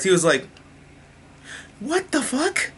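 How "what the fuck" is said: high, stunned what the fuck; jaw-dropped squeak of disbelief; shocked disbelief. Retold or imitated. Oliver learning Maseo gave Ra's the virus, shocked squeak. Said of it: "that was literally his face".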